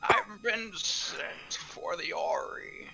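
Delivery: put-on slow, creaky old man. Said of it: "change your timber to be an old man".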